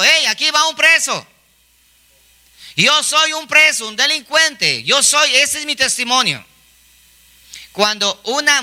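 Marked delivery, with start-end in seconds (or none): 0.0-6.4 high-pitched proclamation, not a full shout; mock crying out. Preacher acts a prisoner declaring himself publicly, mock crying out. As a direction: declare it high, like announcing yourself to the street. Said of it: "él menciona a gritos diciendo".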